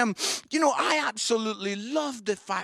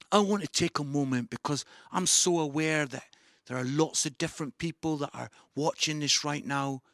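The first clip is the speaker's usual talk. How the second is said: ordinary talk, nothing marked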